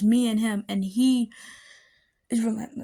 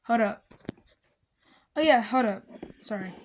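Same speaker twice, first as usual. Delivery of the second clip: usual speaking voice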